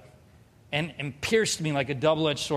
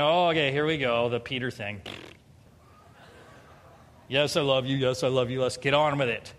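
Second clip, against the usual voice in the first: bored, going through the motions; 5 semitones lower than usual. Retold or imitated. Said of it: "That's how I'm feeling".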